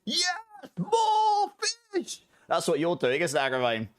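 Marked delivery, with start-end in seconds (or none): 0.0-2.2 high, squealing mock cheer for more fish; a hammed-up gleeful squeal, much higher. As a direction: cheer like a delighted pet character. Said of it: "with his Buster Sword going".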